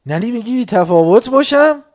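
indignant complainer. Retold or imitated. Preacher voicing complainer's loud offended question. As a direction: throw it back as offended. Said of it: "بهش میگی".

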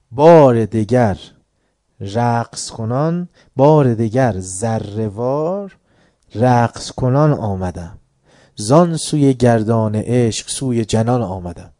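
slow, drawn-out recited verse; poem recitation. Shown as a demonstration. Teacher reciting Rumi's verse to students, slowly. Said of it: "ببین این آیه رو داره چی میگه".

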